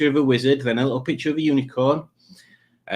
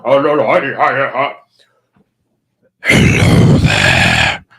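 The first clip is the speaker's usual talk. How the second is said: Oh Judge; 11 dB louder, slower than usual. Acted. Host shows viewers his best Oh Judge voice, loud hammy impression. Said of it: "do my best Oh Judge voice".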